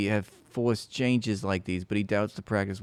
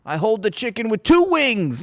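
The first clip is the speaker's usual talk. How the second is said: mock quote; 10 semitones higher, 6 dB louder than usual. Streamer voicing the chicken swinger.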